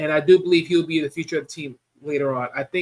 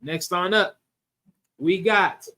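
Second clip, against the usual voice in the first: usual speaking voice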